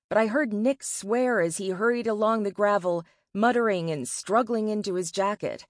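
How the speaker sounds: plain reading voice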